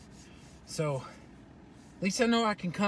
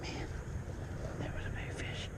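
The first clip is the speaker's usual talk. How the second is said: whispering; 16 dB quieter than usual. Real, not acted. Whispered to himself after catch.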